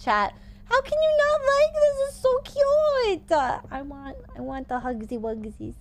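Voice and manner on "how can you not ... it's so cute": high, slow gushing squeal; gushing over cute. Real, not acted. Streamer gushes to chat over a cute dinosaur, high and slow. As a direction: melt over how adorable it is.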